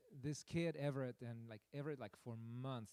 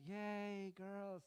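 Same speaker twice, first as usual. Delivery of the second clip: mock cheer; 5 semitones higher than usual. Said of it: "singing and chanting and all happy".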